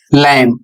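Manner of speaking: लैम। said as "lam", silent b; correct pronunciation. Shown as a demonstration. Teacher shows students the right way to say lamb. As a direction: say लैम। with no b sound at the end. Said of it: "इसका सही उच्चारण होगा".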